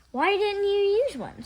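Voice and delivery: high, loud puzzled question; puzzled child. A narrator's reading. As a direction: ask the obvious. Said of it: "said Effie Lou".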